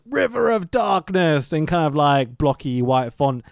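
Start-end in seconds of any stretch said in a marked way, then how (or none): 0.1-1.5 slow, high, drawn-out title reveal; mock title reading. Podcaster reading the film's last title, mock dramatic. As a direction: stretch the title out with hammy drama. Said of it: "the last title says".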